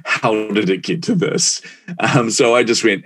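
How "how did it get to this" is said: a hint of wounded dismay, slowing on the question; wince, then ask slowly how it came to this; mock hurt ouch, half laughing; rueful inner voice. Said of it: "the other half of me is just going".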